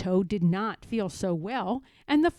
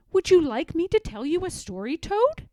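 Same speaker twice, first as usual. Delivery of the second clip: higher and quicker, rising on Toad; eager frog, offering brightly; Frog's voice; 6 semitones higher than usual. Acted. Frog offering his sick friend a story, high and eager. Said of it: "Frog said".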